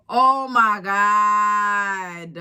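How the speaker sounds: long, low wail of disbelief; exasperated disbelief. Real, not acted. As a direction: stretch every word out, appalled. Appalled reaction to the story.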